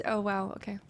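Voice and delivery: ordinary delivery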